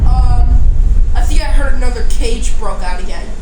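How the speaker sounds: loud, urgent call; pretend alarmed report. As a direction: raise the alarm to the team. Alarm that another cage broke.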